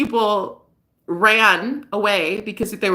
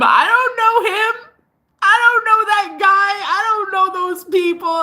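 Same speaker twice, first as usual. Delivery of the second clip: high, loud insistence; exasperated protest; 11 semitones higher, 4 dB louder than usual. Real, not acted. Woman disowning her ex's friends, high-pitched exasperated protest.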